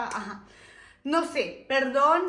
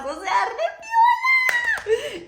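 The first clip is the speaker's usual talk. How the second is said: much higher, slow and drawn-out rave; excited squeal, much higher and louder; gushing; 8 dB louder, much slower than usual. Real, not acted. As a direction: swoon over how cool they were. Gushing about the art students.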